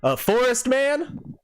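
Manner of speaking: high, hearty drawling hunter-woman voice; folksy frontier woman. Acted. GM voicing the lodge owner greeting the party, hearty high folksy voice. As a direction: greet the travelers like a friendly backwoods proprietor. Owner mocks the fake name.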